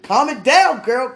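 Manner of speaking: high and loud, still giddy; excited. Real, not acted. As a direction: shout it while still worked up. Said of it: "let me stop".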